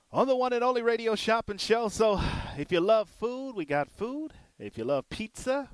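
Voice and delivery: upbeat radio sales pitch; radio announcer. Acted. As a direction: sell it like a radio host.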